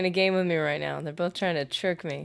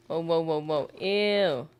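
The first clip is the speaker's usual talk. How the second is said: normal talking voice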